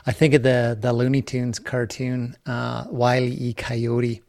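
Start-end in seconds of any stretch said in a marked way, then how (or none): 2.9-4.2 Coyote as kai-OH-tee in the name; three-syllable coyote. Shown as a demonstration. Host recalls Looney Tunes, Coyote in three syllables. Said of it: "you say coyote".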